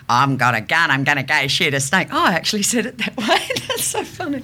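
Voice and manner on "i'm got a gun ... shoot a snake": low, exaggerated Aussie voice; broad Australian accent. Practising the coach's snake line, broad low Australian accent. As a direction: drop into a thick Australian accent. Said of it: "my Australian has got so much better".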